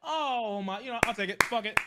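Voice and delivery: ordinary talk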